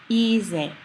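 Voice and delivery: "ize" as EE-zeh, first vowel long; long stress. Shown as a demonstration. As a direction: stretch the ee of "ize", buzz the z. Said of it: "we have a long stress again".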